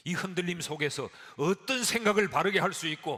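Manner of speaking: high, loud impassioned sermon; a pastor pouring out passion to his flock; full-force preaching. Real, not acted. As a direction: preach it with all your fire.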